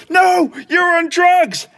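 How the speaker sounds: high, drawn-out maternal scolding; accusing mum. Retold or imitated. Mum insisting Clem is on drugs, accusing. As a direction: mimic Mum refusing to listen. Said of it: "And she goes".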